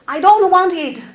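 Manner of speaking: loud, high mock outburst; harsh, un-English demo. Shown as a demonstration. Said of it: "does not sound like English".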